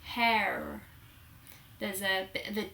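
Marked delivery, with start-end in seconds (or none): 0.0-0.8 "hair" in an American accent, R fully sounded; American "hair" with a strong final R. Shown as a demonstration. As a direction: imitate an American saying "hair". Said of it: "whereas Americans would say hair".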